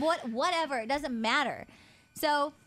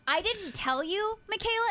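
much higher, slowed, indignant demo; imitating angry tone; 9 semitones higher than usual. Showing how she scolded her sister by full name, high indignant. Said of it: "I was talking to her like this".

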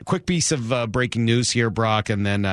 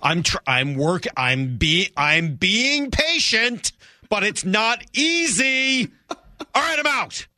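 high, slow strained whine; strained fan; 8 semitones higher, slower than usual. Retold or imitated. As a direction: whine it out, patience snapping. Fan voice cracking under strain.

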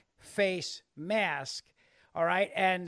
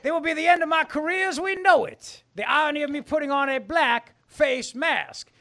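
high, loud Southern politician gloat; hammy Foghorn Leghorn boasting; Southern accent; 5 semitones higher, 5 dB louder than usual. Pretend. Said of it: "It's my Southern accent".

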